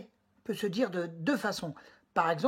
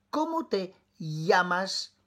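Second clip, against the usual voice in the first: ordinary delivery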